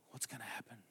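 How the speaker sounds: quiet frightened gasp; a hushed, fearful what's gonna happen. Retold or imitated. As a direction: catch your breath in mock dread. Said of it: "we get fear".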